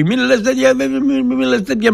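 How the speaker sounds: a hint of a frightened colleague begging him to stop; imitating a nervous friend. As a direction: mimic him anxiously shushing you. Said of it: "και λέει".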